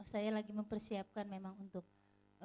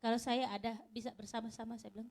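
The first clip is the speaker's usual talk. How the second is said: ordinary delivery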